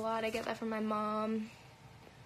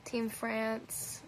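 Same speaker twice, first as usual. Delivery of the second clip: ordinary talk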